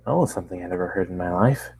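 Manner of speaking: plain reading voice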